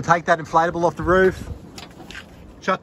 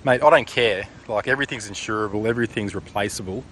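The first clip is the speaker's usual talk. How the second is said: ordinary delivery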